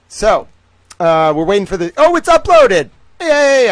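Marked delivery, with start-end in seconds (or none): 2.0-3.7 high, loud burst of glee; real excited squeal of surprise; delighted excitement. Real, not acted. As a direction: cheer as the wait suddenly ends. Upload finishes while waiting.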